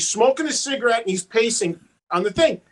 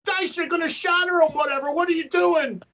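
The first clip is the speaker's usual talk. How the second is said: higher, amused scolding; mock scolding of Dice; teasing protest; 8 semitones higher than usual. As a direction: chide Dice with a laugh in the voice. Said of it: "you were like".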